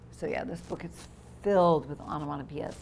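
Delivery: usual speaking voice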